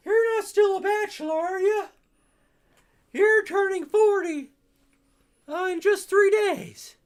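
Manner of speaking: put-on high old lady teasing; granny voice. Granny teases the bachelor detective in a high, loud old-lady voice.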